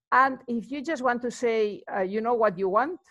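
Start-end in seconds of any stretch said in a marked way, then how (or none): none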